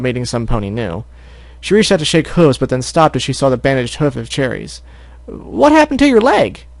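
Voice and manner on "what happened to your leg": high slow filly question; concerned filly. Acted. Apple Bloom asking about the hurt hoof, high voice. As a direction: ask with worry as a filly.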